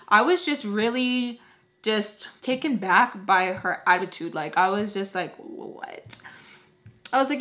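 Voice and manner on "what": breathless whispered what; stunned whisper. Retold or imitated. Stunned by her friend's attitude, a whispered what. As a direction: mouth the word in disbelief.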